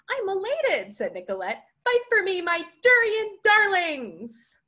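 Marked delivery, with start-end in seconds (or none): 0.1-0.9 high-pitched gush; delighted, much higher voice; elated. Acted. Nicolette gushes over video call. 1.8-4.6 high, loud, drawn-out rallying cry; theatrical high-pitched plea, stretched at the end; dramatic cheering on. Acted. Nicolette cheers protesters on dramatically.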